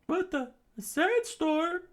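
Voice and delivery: very high falsetto shock; slow, loud disbelief up in falsetto; startled alarm. Acted. Rebels startled by the storm.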